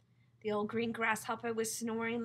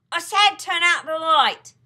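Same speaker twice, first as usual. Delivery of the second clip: slow, furious shout, much louder and higher; angry shout; 7 semitones higher, 18 dB louder, slower than usual. Acted. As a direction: bellow it, fed up with being ignored. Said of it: "shouted the centipede angrily".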